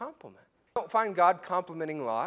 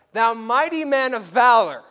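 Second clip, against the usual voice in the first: loud, high booming proclamation; proclaiming God; 7 semitones higher, 14 dB louder than usual. Acted. God hailing Gideon as a warrior, loud and booming. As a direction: announce it like a herald.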